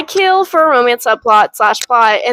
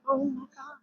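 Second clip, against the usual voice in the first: a breathy, almost silent oh my God; stunned hushed; 2 semitones higher, 18 dB quieter than usual. Retold or imitated. Reader stunned by the massacre, nearly silent. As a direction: barely voice it, shocked.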